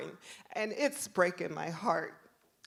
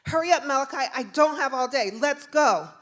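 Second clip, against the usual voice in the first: loud, raised commanding voice; impatient officer; 6 semitones higher, 10 dB louder than usual. Retold or imitated. Officer rushes crying boy, loud impatient order. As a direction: order the kid along impatiently.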